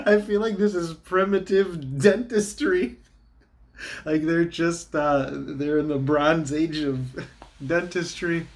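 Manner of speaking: high, laughing mockery. Real, not acted. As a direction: laugh at the absurdity. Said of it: "I'm laughing".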